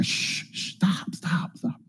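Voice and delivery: whispered shushing, acted out; hushed rebuke. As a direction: shush him urgently under your breath. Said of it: "And many rebuked him".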